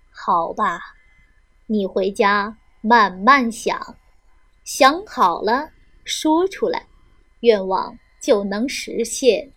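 put-on low, slow old beggar. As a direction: speak slowly like a wise old beggar. Beggar grants wishes.

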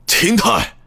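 high, sharp bellow; roaring shout. Acted. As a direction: roar his name. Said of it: "大声咆哮道".

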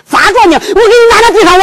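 high, rapid-fire stage outburst; acted furious husband; angry threat. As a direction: explode at the wife, racing through it. Husband threatens his wife in quarrel.

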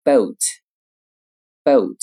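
boat in a British accent, rounded vowel; teacher reading boat in British English. Shown as a demonstration. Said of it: "英音读成".

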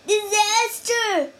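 high, loud alarmed cry. Acted. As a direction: burst in shouting the bad news. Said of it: "one guard cried".

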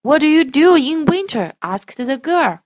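loud, slow storybook voice; reading to children. Shown as a demonstration. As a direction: read it slowly and grandly for a child. Parent models expressive reading.

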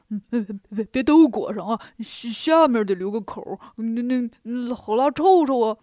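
stammering, doltish pig-man voice; bumbling pig disciple, stumbling over his words; flustered Bajie. Acted. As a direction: protest haltingly as a flustered Bajie. 八戒 role, flustered protest.

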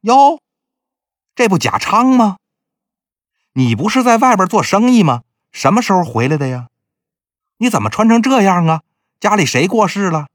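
lightly voiced busybody greeting a familiar face; old matchmaker's voice. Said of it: "李牙婆一看".

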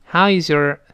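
“how is your” joined into one run; linked phrase. Shown as a demonstration. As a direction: link “is your” as iz-yer after “how”. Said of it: "是这么一个声音啊". (“how is your”连读成一串)

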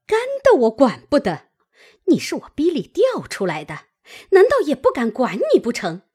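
fast, loud, high-pitched tirade; angry cursing. A narrator's reading. Mother slaps her daughter and curses her, fast and furious. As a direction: berate her without letting up. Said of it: "骂道".

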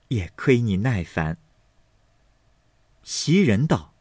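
measured, unhurried audiobook storytelling; story narration. A narrator's reading. Narrator reading a classic novel to listeners, measured storytelling.

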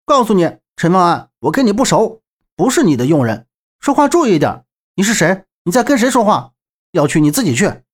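high, irritated rebuke; indignant snapping. A narrator's reading. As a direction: tell someone off for ordering you around. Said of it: "扭头喝道".

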